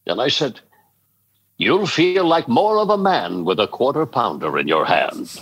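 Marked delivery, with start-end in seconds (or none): 1.6-5.3 rich, theatrical ad-announcer voice; Peterman voice. Retold or imitated. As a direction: deliver it like a smooth TV pitchman. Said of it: "done as Peterman".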